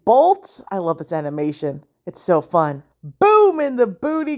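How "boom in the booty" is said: high, triumphant cheer; gleeful high-pitched call; gleeful exclamation. Real, not acted. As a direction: celebrate the knockout in a raised pitch. His big move lands.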